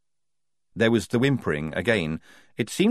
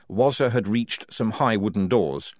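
plain reading voice

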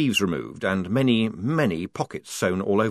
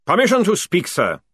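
loud parade-ground bark, pitched up; 9 semitones higher, 6 dB louder than usual. A narrator's reading. Sergeant asking his lieutenant to speak, barked. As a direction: bark it to your officer. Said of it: "barked Jackram".

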